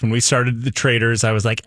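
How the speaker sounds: ordinary talk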